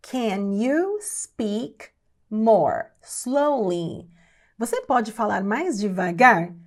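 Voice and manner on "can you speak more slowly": very slow, words spaced apart; slow teaching pace. Shown as a demonstration. English teacher models asking someone to slow down, very slowly. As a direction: stretch out every word for learners.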